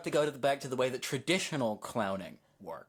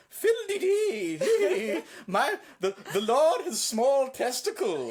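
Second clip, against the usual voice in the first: court jester; 10 semitones higher, 6 dB louder, slower than usual. Retold or imitated. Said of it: "he goes".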